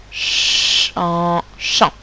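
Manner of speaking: long Ch, then en, then blended chant; sound blending. Shown as a demonstration. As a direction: sound out Ch and en before joining them as chant. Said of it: "j'entends deux sons".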